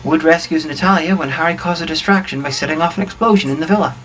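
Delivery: normal talking voice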